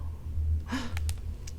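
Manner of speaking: sharp unvoiced gasp; soft dramatic gasp. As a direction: gasp in mock fright. Reader gasps at monster.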